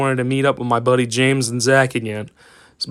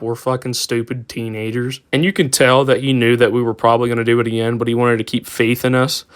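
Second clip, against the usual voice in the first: ordinary delivery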